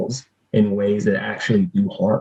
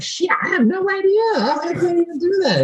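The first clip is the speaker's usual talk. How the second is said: very high squeaky retold protest; imitating your own helpless outburst, way up high; mock-helpless squeal. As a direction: squeal it out as if totally clueless. Retells panicked reply to therapist.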